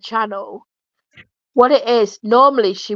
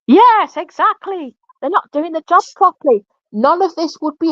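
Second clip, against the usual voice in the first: outraged agreement; 8 semitones higher than usual. Real, not acted.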